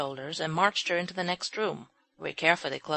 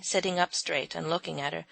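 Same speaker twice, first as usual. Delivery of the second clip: usual speaking voice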